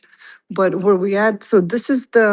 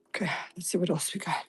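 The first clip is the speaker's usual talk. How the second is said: whispering; 12 dB quieter, faster than usual. Real, not acted. Quiet aside while browsing exhibits.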